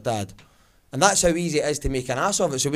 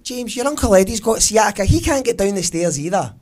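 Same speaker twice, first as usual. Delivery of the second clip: higher, louder dismissive father; dad's voice; 6 semitones higher, 6 dB louder than usual. Retold or imitated. Dad telling son about Uncle Eddie, higher blunt dad. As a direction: wave it off like a blunt old dad.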